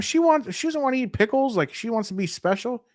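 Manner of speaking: high-pitched comic exasperation; high, exaggerated protest; mock indignation. Real, not acted. Mock outrage at fiancee's pickle request.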